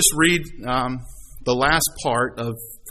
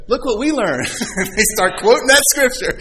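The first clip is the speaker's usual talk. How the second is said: laughing; 8 semitones higher, 3 dB louder than usual. Real, not acted. Daughters showing off, teller laughing.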